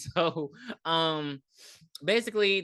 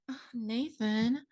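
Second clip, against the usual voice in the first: high, slow swooning "Oh, Nathan"; mock lovestruck sigh of a name; playful imitation of a smitten girl; breathy swoon; 6 semitones higher, 3 dB quieter, slower than usual. Tori swooning over Nathan.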